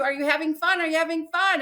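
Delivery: high, eager repeated question; chirpy instructor. Retold or imitated. Imitating the instructor pestering her mid-fall. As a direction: pester like a grinning guide. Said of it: "And he tells you".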